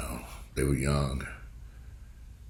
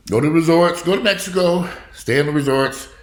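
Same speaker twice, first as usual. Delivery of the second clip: high, louder insistence; emphatic advice; 9 semitones higher, 5 dB louder than usual. Real, not acted. Advising viewers going to Mexico, loud insistent advice. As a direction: drive the advice home.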